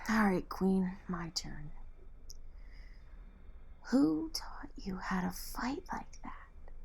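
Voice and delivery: hushed playful question; whispering. Acted. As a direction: whisper it teasingly. Whispers throughout.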